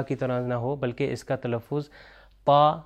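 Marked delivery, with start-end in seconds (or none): 2.4-2.8 پا as a heavy, emphatic Arabic ط; thick Arabic taa. Shown as a demonstration. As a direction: say پا loud with a thick t, tongue tip at the upper tooth roots. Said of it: "اس کو تھوڑا سا موٹا تلفظ کریں".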